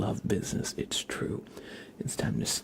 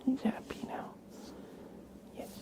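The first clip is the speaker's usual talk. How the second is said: soft coaxing whisper; 12 semitones higher, 7 dB quieter than usual. Real, not acted. Whispering to coax the cat.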